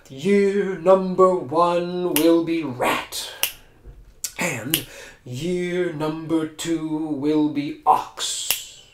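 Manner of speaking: slow, stately emperor; regal proclamation. Acted. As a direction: announce it slowly like an emperor.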